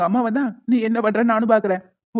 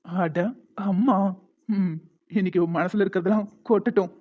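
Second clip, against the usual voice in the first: ordinary delivery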